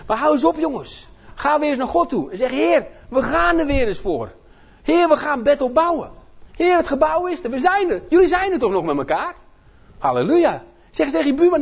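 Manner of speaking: high, loud rallying sermon; preaching. Acted. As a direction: urge the church on fervently. Preaching, quoted prayer.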